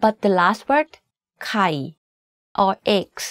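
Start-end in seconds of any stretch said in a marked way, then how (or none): 1.5-1.7 ไข่ low with a slight fall; Thai low tone. Shown as a demonstration. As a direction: sink ไข่ to the bottom of your voice. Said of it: "is in low tone".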